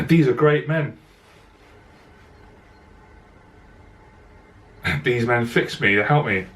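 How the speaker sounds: real emotion held back, with long pauses; choked up, pausing to collect yourself; moved, halting and clearing the throat; emotional. Real, not acted. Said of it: "I get emotional".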